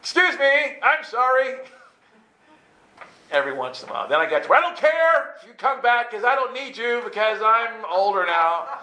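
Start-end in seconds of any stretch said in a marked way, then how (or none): 0.0-1.5 high, loud apologetic call; calling after someone. Retold or imitated. Repeats the call for years. 4.5-8.7 high, loud mock defiance; defiant outburst.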